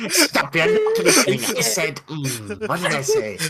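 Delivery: high, laughing mock psychic; laughing mimicry. Co-host mimicking the forgetful psychic, high and laughing.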